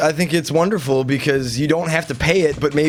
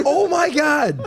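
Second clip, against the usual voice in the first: shocked; 13 semitones higher, slower than usual. Real, not acted. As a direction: gasp it out in disbelief. Shocked by picture.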